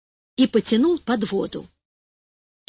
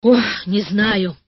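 usual speaking voice

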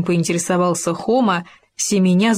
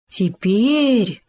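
drawn-out, delighted "теперь" falling at the end; rapturous little exclamation; 3 semitones higher than usual. A narrator's reading. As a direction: exclaim it with swelling wonder. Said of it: "восторженно сказал Хома".

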